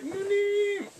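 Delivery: high, drawn-out call; mock calling out. Underlings calling to their boss, high mock call. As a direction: sing out the title in an imitated wail.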